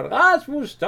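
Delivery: a playful imitation of shouting his name; mock shout. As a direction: call out to Rasmus to stop, half in jest. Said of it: "Jeg råber".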